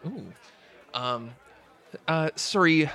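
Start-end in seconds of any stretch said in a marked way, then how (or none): 2.1-2.9 "sorry" slipping into Canadian vowels; accidental Canadian accent. Acted. Server apologizes to customer, "sorry" slipping into Canadian. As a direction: say "sorry" with a Canadian o, higher pitched. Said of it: "I went Canadian there".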